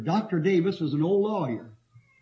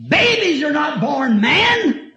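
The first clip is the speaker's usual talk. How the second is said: preaching shout; 7 semitones higher, 5 dB louder, slower than usual. Real, not acted. Preacher crying out his point.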